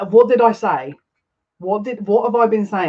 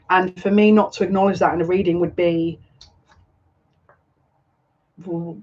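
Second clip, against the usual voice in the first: ordinary talk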